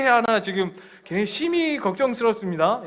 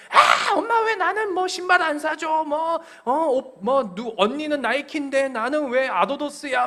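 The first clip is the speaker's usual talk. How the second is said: put-on high, whining teenage complaint; high whining daughter nagging her mother; whiny daughter; 6 semitones higher than usual. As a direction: whine at mom for sneakers. Whining daughter voiced in story.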